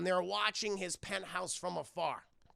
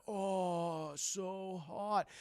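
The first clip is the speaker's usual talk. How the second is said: mock lustful voice; much slower than usual. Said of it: "hear Jackie Chan do those voices".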